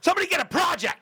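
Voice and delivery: high-pitched frantic demand; going nutty. Retold or imitated. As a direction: blurt it out high and fast, going stir-crazy. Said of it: "You get a little nutty".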